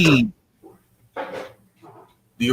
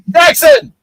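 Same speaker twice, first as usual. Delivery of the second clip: loud, high call of the name; 9 dB louder than usual. Real, not acted. Streamer calling a chat member by name, loud and high. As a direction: shout his name across the room.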